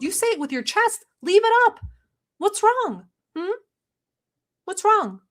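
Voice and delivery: mock taunting. Taunting Chantal about deleting her rant, high mocking sing-song. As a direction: goad her in a mocking baby-talk voice.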